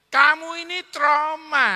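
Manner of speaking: loud, high and slow; emphatic declaration. Real, not acted. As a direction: land the word hard on the crowd. Preacher's emphatic diagnosis.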